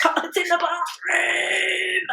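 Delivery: high, slow, drawn-out moan; mock labour wail. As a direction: moan the words as if mid-push. Acting answer while pushing.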